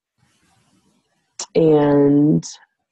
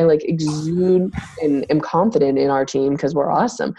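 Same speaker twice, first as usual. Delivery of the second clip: ordinary talk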